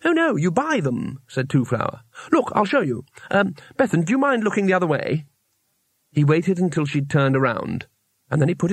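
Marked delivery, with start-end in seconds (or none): 0.0-1.2 high, helpful little voice; lightly voiced naive traveller; eager tourist. A narrator's reading. Two Flower voiced, eagerly helpful. 2.3-5.3 higher, politely fussy voice; lightly voiced naive traveller; eager tourist. A narrator's reading. Two Flower voiced, about to demonstrate.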